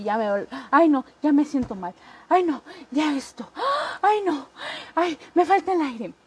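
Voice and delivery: quejido que sube, más agudo al final; hipocondriaca quejosa. Retold or imitated. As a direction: finge ahogo de hipocondriaca. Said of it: "la hipocondriaca que dice". (hipocondriaca exagerando su malestar, quejosa)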